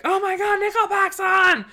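high, mock fangirl squeal; screaming fan freak-out. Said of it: "they freak out and scream".

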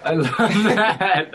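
high, drawn-out words through a laugh; laughing delight. Real, not acted. Bandmate hearing of the Christmas album tradition, laughing. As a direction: laugh as you say it, stretching it out.

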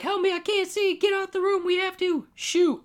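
very high squeaky cartoon voice, slowing at the end; a blinded cop squealing for help, high and silly; whiny wounded cop. Blinded cop begging partners, squeaky high cartoon voice. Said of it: "So he's like".

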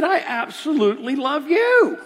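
high, loud, wholehearted proclamation; emphatic declaration. Retold or imitated. Pastor modelling love for his friend, emphatic declaration. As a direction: belt out the affection without reservation.

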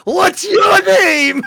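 very high, loud, drawn-out holler; shouted question. Retold or imitated. Downed pilot calls to his falling enemy, hollering. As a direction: yell it slowly to someone far off. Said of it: "the guy says in Russian to him".

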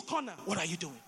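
dropped to a near whisper; quiet. Shown as a demonstration. Preacher asks the congregation, hushed and low. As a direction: ask it softly, almost to yourself.

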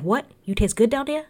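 high, incredulous mock Jersey accent; Frank. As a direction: question it in disbelief, putting on Jersey. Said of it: "don't mind my Jersey accent".

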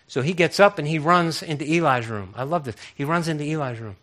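ordinary delivery

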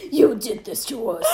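mock blaming. Co-host plays blaming sister.

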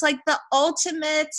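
usual speaking voice

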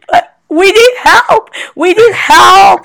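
high, slow, shouted plea; mock cry for help. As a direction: yell it like a fake emergency. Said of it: "I'm like".

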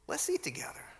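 an intimate hush, no voice at all; whispered invitation. Retold or imitated. Jesus whispering to his disciples, inviting them to eat. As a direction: breathe it out as a whisper.

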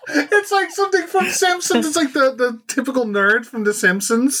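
high laughing talk. Real, not acted. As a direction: speak through laughter. Laughing at the clip.

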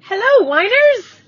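loud, high, drawn-out hello to the fans; hyped greeting. Real, not acted. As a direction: belt out a long, high welcome. Hyped show-opening greeting to listeners.

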